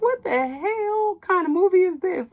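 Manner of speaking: high, slow, disbelieving cry at the screen; incredulous outburst. Retold or imitated. Viewer baffled by the movie plot, high slow disbelief. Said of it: "I'm like".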